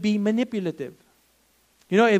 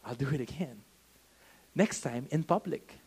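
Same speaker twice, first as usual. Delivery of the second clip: put-on sly child whisper; 3 semitones lower, 8 dB quieter than usual. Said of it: "they'll say".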